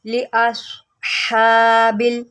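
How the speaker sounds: लिअस्हाबिल with a heavy emphatic s, slow; emphatic saad; saad sound. Shown as a demonstration. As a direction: thicken the s of लिअस्हाबिल. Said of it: "सौद है, इसको सौद पढ़ें".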